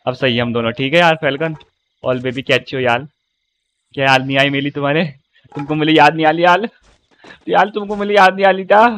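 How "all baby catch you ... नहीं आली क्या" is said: put-on playful lisped baby voice; mock toddler pleading; baby talk. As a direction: turn r into l like a toddler.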